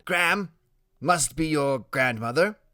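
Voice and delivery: put-on boy genius voice. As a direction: deduce it like a little detective.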